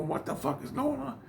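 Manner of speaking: high, baffled cry of confusion; waking up confused. Retold or imitated. Waking in hospital, high and baffled. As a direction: sound lost and startled as you come to. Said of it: "I'm, I'm like".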